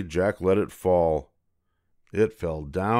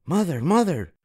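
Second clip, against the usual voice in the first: put-on high excited boy calling out; 10 semitones higher, 3 dB louder than usual. A narrator's reading. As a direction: call out to mum, thrilled. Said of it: "Jack called".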